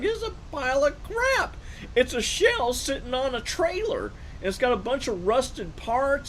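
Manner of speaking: high, slow, sneering put-down; mocking scorn. Telling the other guys what the car is, mocking. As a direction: ridicule the junk car.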